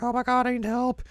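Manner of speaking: a hammed-up cry for help; mock panic. Retelling Kagome, high hammed-up plea for help. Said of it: "she's like".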